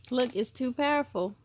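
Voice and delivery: ordinary delivery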